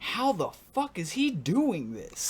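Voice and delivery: amazed. Retold or imitated. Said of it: "And they went".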